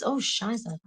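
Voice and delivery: ordinary delivery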